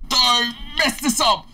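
urgent yell. Real, not acted. Urging partner in game.

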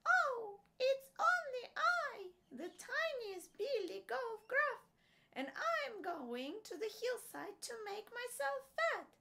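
tiny, very high-pitched little goat; small goat voice. A narrator's reading. Youngest billy goat answering the troll, small high voice. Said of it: "with such a small voice".

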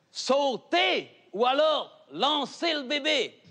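high, slow drawn-out call; called-out plea. Acted. Fireman urging the mother at the window, slow high call. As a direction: stretch the call up the building.

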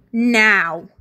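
sharp loud command; shouted order. Acted. Rebel girl shouting the signal to the kids, loud command. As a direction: yell the signal to attack.

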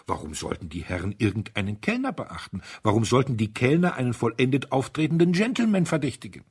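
usual speaking voice

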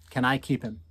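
usual speaking voice

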